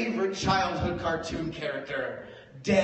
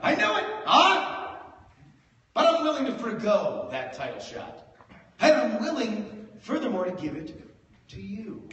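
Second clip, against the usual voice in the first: mock solemn bargain; 6 semitones higher than usual. Comic mock-negotiating with drunk friend, hammed-up. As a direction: play it grand and sincere.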